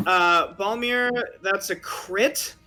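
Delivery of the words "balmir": ordinary delivery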